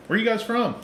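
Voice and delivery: high, sing-song imitation of a stranger; lightly mock the curious bar patron. Stranger at the resort bar asking, high mocking voice.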